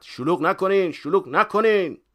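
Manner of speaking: high, loud shouts for order; insistent yell, stretched on the repeat; repeated shouting. A narrator's reading. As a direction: shout over a rowdy crowd.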